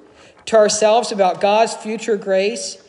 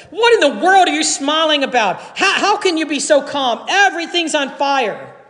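alarmed employee; 7 semitones higher than usual. A narrator's reading. Employee alarmed at calm boss.

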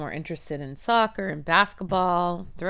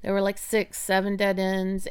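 ordinary talk, nothing marked

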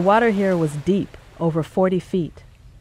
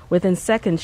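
plain reading voice

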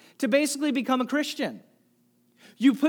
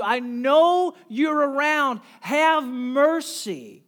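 loud, slow, stretched-out plea; crying out; 5 semitones higher, 7 dB louder, slower than usual. Acted. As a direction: call out to someone nearby, dragging each word. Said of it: "he's crying out, shouting out".

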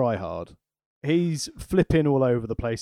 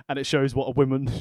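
normal talking voice

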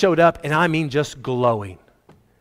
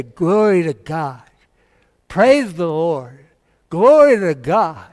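slow, devout, drawn-out praise; dying man's praise; 5 dB louder, slower than usual. Retold or imitated. Old man in hospital praising God, slow devout chant. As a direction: murmur it like a last prayer, slowly swelling.

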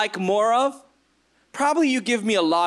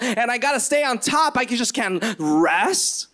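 higher, rushed rant of an overwhelmed objector; indignant workaholic protest; 4 semitones higher than usual. Acted. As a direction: reel off the excuses in mock panic. Acts out modern people objecting to Moses.

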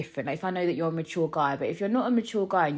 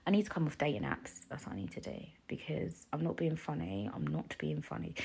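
unvoiced whisper, much softer; whispered aside; 4 semitones lower, 10 dB quieter than usual. Real, not acted. Confessing to listeners she must quit apps, whispered. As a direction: confide it in a hushed whisper.

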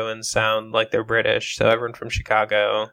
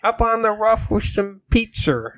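raised-pitch mock Chicago accent; 8 semitones higher than usual. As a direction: put on a high, hammed-up Chicago accent. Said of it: "as good a Chicago accent as we're gonna get".

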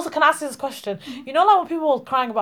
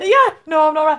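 mock sad reply; 3 semitones higher, 5 dB louder than usual. Acting out a sad oversharer.

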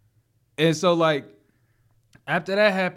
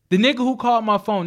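ordinary delivery

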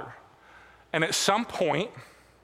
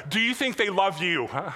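ordinary talk

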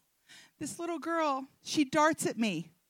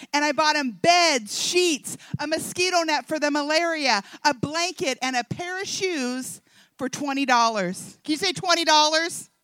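ordinary delivery

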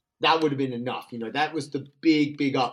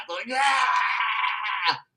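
ecstatic scream; 8 semitones higher than usual. Retold or imitated. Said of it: "lost part of my, like, spleen".

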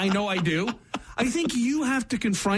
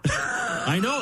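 ordinary talk, nothing marked

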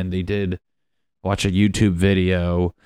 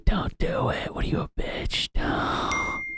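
taunting inner voice; 10 semitones higher, 8 dB quieter than usual. Retold or imitated.

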